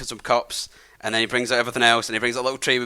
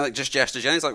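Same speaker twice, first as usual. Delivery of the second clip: usual speaking voice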